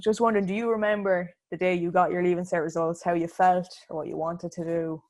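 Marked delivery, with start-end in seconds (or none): none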